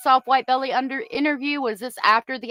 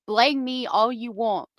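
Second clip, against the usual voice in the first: usual speaking voice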